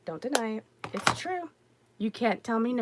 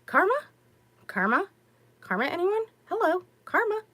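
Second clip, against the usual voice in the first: sarcastic karma; 9 semitones higher than usual. Retold or imitated. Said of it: "And I'm like".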